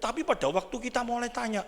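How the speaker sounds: normal talking voice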